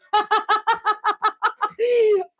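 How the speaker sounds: high drawn-out giggle; real bashful laugh; long high laugh. Real, not acted. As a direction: laugh off the compliment. Laughing at viewer comment.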